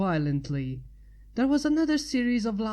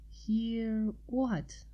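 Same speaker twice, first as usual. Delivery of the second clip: softer hushed question; quietly; 3 semitones higher, 6 dB quieter than usual. A narrator's reading. Twilight to Pinky in the dark: hushed question. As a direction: ask it under your breath. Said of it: "Twilight asked quietly".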